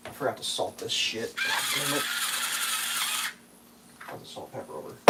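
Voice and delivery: quiet, real self-directed grumbling; muttered aside. Real, not acted. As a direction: mutter to yourself, off to the side.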